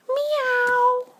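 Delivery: a high, sing-song cat meow. Pretend. A parent voicing the kitty's meow for the child. Asking what the kitty says.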